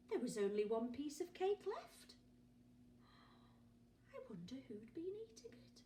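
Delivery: plain reading voice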